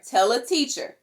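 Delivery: usual speaking voice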